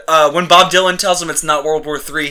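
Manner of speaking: normal talking voice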